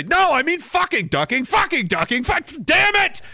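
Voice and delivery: hammed-up rage at autocorrect; frustrated shouting at phone. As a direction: yell at the phone in exasperation. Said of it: "you're trying to just say".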